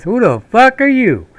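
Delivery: gruff challenge. Retold or imitated. Sergeant confronting stranger in civvies.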